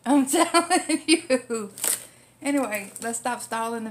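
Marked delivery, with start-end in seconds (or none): 0.0-1.7 slow, loud, high words through laughter; real belly laugh while talking. Real, not acted. Laughing after joking about karma.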